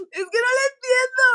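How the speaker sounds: high, tearful plea; crying. Retold or imitated. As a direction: whimper it through tears. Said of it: "yo me puse a llorar". (al señor del teléfono, llorando)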